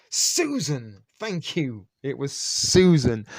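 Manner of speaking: loud cry of sudden recall; eureka. Real, not acted. Host finally recalling the Seinfeld girlfriend's name, a loud relieved cry. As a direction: shout the name as it finally comes back.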